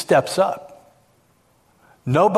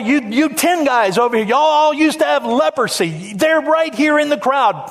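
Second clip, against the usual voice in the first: high, animated public address; single people out across a big crowd, high and insistent; calling out the crowd; 6 semitones higher than usual. Acted. Peter points out healed people in the crowd, calling out excitedly.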